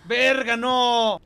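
loud, high shocked shout; startled yell. Real, not acted. Gamer hit by a jump scare, startled yell. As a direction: jump out of your skin.

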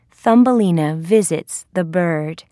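ordinary delivery

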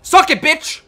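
high, loud gloating yell; triumphant shout. Real, not acted. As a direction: shout a taunt at a beaten opponent. Taunting after getting a kill.

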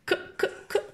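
ك as a short unvoiced "k", three times; plain K sound. Shown as a demonstration. Teacher shows how the letter sounds, short separate K. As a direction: tap out ك crisply, separately. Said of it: "بنقولها ك عادي".

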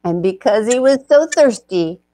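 lively storybook narration; story narration. A narrator's reading. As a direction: tell it like a picture book read aloud.

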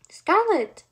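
high, loud shout of a name; loud cry. A narrator's reading. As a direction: cry out urgently for a missing sister. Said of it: "louder than before".

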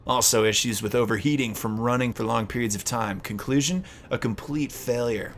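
soft, quiet document read. Acted. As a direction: read the file like a report. Reads game document aloud.

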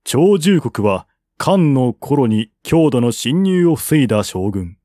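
ordinary delivery, nothing marked

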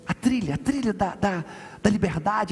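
usual speaking voice

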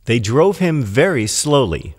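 plain reading voice

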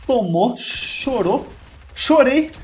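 high teasing sing-song; mock taunt after a kill. Real, not acted. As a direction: rub it in playfully. Taunting a killed opponent.

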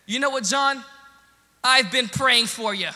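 loud, high, pointed declaration; mad but sounding nice. Retold or imitated. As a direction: throw kindness like a challenge, loudly. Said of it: "really mad, but you sound nice".

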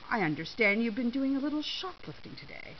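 self-important, insinuating store official; pompous official. A narrator's reading. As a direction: accuse with pompous condescension.

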